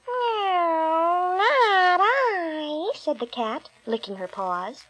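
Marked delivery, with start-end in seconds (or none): 0.1-2.9 high, drawn-out snooty cat; snooty bored cat. Acted. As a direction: turn her nose up like a bored cat. Cat refuses, voice put on.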